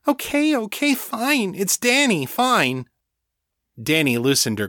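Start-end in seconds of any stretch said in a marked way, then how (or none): 0.1-2.9 very high, placating squeal of words; cringing backdown. A narrator's reading. Rebecca backing down after Danny grabs her, cringing. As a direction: give in fast, pitched right up. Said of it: "Rebecca cringed".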